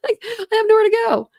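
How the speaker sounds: high, loud wailing fake cry; mock wail. Mock lament about hotel price.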